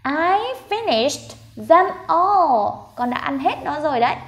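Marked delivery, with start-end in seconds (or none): 0.0-2.7 very slow, pitched high, each word separate; no rush, stretch every word for learners; slow model reading. Shown as a demonstration. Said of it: "Đọc chậm thôi, không cần đọc nhanh đâu". (đọc chậm, không vội)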